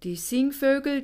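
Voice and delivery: usual speaking voice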